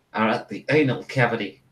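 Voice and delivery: normal talking voice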